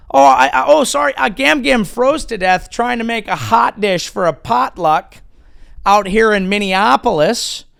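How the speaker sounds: high, loud flustered Minnesotan; hammed-up apologetic Upper Midwest voice; Minnesota accent. Comic mock Minnesotan telling of Gam Gam freezing.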